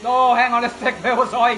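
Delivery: startled, breaks into a laugh; sudden surprised outburst. Real, not acted. Commentator losing his place mid-call, loud startled burst with a laugh.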